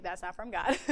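said through a laugh. Retold or imitated. Preacher laughing while retelling Bev's first reaction. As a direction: laugh it off as you say it. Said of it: "'cause she's like".

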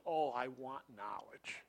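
whispered and slow; a gasping disciple whispering his wish; breathless whisper. Retold or imitated. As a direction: say it spent and out of breath, barely voiced. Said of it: "He says".

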